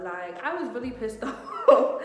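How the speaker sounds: amused confession breaking into laughter; laughing. Real, not acted. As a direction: burst out laughing on the last word. Laughs while admitting her anger.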